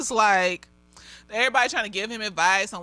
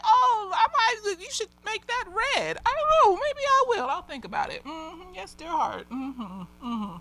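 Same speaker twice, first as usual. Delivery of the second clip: calm partner; 8 semitones higher than usual. Pretend.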